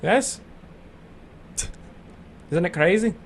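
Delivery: normal talking voice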